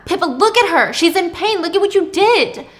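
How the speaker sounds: loud, high, angry accusation; angry reproach. Acted. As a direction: make her look at the damage, loudly. Thomas mad at Pippa.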